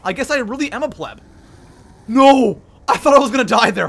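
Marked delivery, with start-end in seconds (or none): 2.1-3.9 loud yelp, then rushed relief; panicked outburst. Real, not acted. Gamer nearly dies mid-game, panicked shout. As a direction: blurt it out after a near miss.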